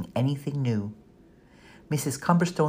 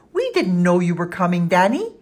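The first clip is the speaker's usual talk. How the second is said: louder, higher happy shout; excited shout; 4 semitones higher, 6 dB louder than usual. A narrator's reading. Twins greeting Danny from the stairs, shouting. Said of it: "shouting in unison".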